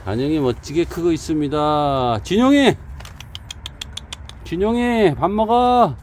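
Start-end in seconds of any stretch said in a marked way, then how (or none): 2.2-2.8 loud high call across the yard. Real, not acted. Calling dog. 4.4-6.0 loud high dinner call. Real, not acted. Calling dog to eat.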